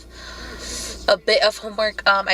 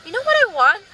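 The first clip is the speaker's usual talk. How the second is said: whiny pleading; 7 semitones higher than usual. Retold or imitated. Whining to husband for food.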